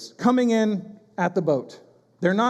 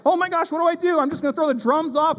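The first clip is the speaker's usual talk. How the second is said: playfully frantic, reaching for anything; mock panic; 7 semitones higher than usual. Us in our own storms, playful mock panic.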